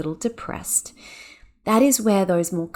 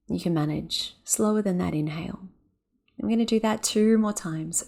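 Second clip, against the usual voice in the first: guided breathing practice. Shown as a demonstration. Podcast host guiding listeners through a breath practice, calm and slow. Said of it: "you can even hear in my voice, I'm talking more slowly".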